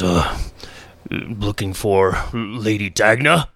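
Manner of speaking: lightly voiced gruff orc, slow; slow, low orc question. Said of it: "the orc says".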